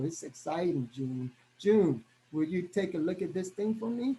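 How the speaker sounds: low, soft, dull sales call with no excitement; low-energy pitch. Shown as a demonstration. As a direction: pitch it flat and bored, voice sinking. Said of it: "What's the difference there? The excitement, the level".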